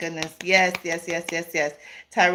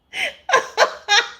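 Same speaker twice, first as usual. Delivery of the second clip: loud high laugh; 3 dB louder than usual. Real, not acted. Breaking into loud laughter at a viewer's comment. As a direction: burst of high-pitched laughter. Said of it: "don't make me laugh".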